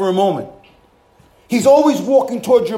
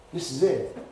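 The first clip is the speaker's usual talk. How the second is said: much lower and softer sermon voice; soft intimate preaching; 8 semitones lower, 6 dB quieter than usual. Acted. Preacher quietly comforting the congregation, hushed sermon. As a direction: draw the congregation in close.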